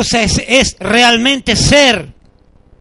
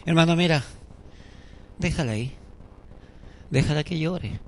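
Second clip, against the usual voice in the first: low, very soft counsel; hushed advice; 7 semitones lower, 16 dB quieter than usual. Acted. Pastor telling the baby's father to leave her, hushed and low. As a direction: advise him quietly, voice dropped low.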